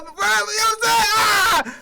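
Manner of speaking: shouting to a friend; excited shout. Retold or imitated. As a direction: hype it up like greeting a buddy.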